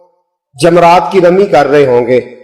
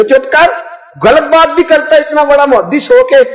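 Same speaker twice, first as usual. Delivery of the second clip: scolding; 9 semitones higher than usual. Retold or imitated. हाजी डांटता है.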